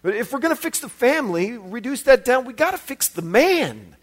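ordinary delivery

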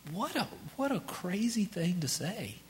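unvoiced whisper of disbelief; hushed, incredulous aside. Real, not acted. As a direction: breathe it out in amazement. Aside after reading Jonah.

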